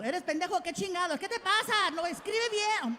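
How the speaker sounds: high scolding Mexican relative; mock family scolding in Spanish. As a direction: squawk it like an outraged aunt. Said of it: "my family called".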